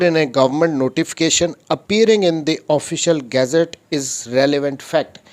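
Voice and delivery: slow, deliberate reading of the law; reading statute aloud. Shown as a demonstration. Lecturer reads the article to his students, slow and deliberate. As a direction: read every word out like a legal text.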